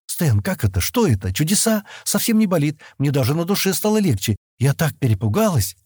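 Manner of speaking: happy amazement. A narrator's reading. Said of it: "расплылось в счастливой улыбке".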